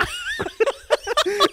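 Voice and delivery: high shrieking burst of laughter; loud laugh. Real, not acted. Laughing hard at her own ocker impression. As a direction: crack up at the bit.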